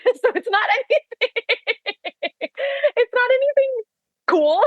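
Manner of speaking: high giggling words; laughing through it, pitch way up. Real, not acted. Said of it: "laughing over dad's word".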